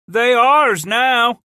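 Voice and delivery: high, slow defiant shout; a slow, high shouted taunt; yelled reply. A narrator's reading. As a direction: yell it back across the wall. Thief yelling back at gate.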